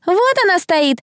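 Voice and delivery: high-pitched pointing-out cry; gleeful yell. A narrator's reading. Crowd member spotting the accused girl, gleeful yell. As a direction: call it out to the whole crowd. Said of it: "радостно завопил кто-то".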